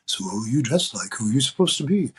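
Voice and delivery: a deliberately bad Robin Williams take, only a hint of him. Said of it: "in my bad Robin Williams imitation says".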